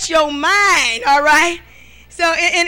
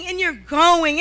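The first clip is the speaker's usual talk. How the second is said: usual speaking voice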